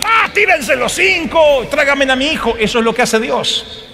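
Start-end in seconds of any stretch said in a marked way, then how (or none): 0.0-2.6 high, urgent acted plea; desperate parent. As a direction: beg the rescuers to jump in, pitched up. Padre desesperado pide rescate. (súplica desesperada y aguda, actuada)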